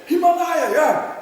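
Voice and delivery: high, loud thrilled cry; old climber thrilled by the book, high and loud; frantic excitement. Retold or imitated. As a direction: gush with delight. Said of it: "He became completely frantic".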